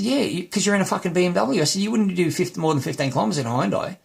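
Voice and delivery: high-pitched retold argument; exasperated retort. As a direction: re-enact arguing with a careless driver. Retold argument with careless driver.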